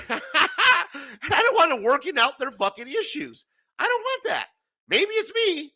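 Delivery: high laughing rant; giggling complaint. Real, not acted.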